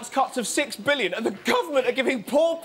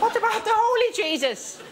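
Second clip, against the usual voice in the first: mock preacher; 11 semitones higher than usual. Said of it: "Some God botherer".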